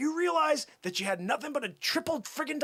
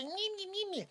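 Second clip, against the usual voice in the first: high, soft whiny mimicry; mock a complainer with tiny high syllables; mocking whiner; 10 semitones higher, 7 dB quieter than usual. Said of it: "trying to challenge me and say".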